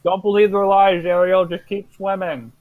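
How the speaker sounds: gurgly fish man, as if mouth full; fishman voice. Acted. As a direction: talk like a fish creature with a mouthful. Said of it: "that's what his fishman voice sounds like".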